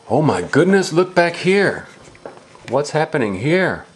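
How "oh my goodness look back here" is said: usual speaking voice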